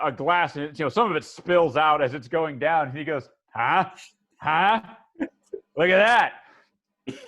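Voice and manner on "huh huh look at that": loud, higher-pitched boast; punchy, pleased-with-himself exclamation; showing off. Acted. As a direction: prompt them proudly to admire your trick. Showing off his trick.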